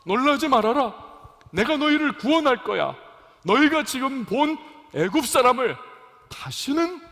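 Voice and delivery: high, loud commanding reassurance; God's spoken promise. Acted. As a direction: proclaim it forcefully as God to frightened people. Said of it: "모세를 통해서 말씀을 주시는 거예요".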